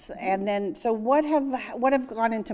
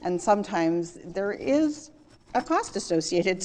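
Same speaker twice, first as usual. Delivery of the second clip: ordinary delivery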